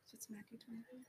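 whispering. Real, not acted. Whispering before service starts.

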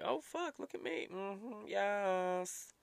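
high, soft preening purr; playful, softly squealed self-approval; self-admiring. Acted. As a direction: admire yourself in the mirror. Voicing someone admiring their glammed-up self.

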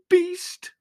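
high, loud tearful cry; tearful, high-pitched outburst; bursting into tears. Acted. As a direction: hurl it out in a high, hurt cry. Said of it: "bursting into tears".

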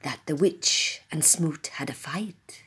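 hushed storyteller whisper. Acted.

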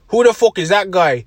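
loud, high, badly faked accent; Conor McGregor. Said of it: "that was a really bad accent".

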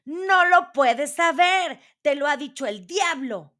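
high, outraged dwarf cry; shouting. A narrator's reading. Dwarf accusing the queen, high angry shout. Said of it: "gritó él".

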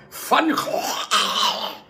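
"Van Gogh" as Fan Khokh, drawn-out throat scrape; exaggerated Dutch pronunciation. Shown as a demonstration. Teacher mocks how the Dutch say the name, exaggerated guttural pronunciation. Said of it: "é assim que eles falam".

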